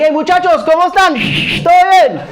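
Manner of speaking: hearty Mexican-Spanish hello to the waiters; Spanish greeting. Retold or imitated. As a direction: greet the staff like old friends. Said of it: "I greet the whole staff in Spanish".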